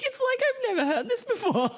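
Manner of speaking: very high, laughing words; giddy laughing retelling; laughing through tears. Real, not acted. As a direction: talk while laughing and teary. Recalling emotional moment, laughing.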